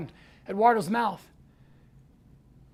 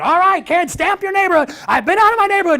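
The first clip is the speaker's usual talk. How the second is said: high, loud excited announcement; rallying shout; 8 semitones higher, 9 dB louder than usual. Retold or imitated. Leader telling kids to stamp their passports, rallying shout.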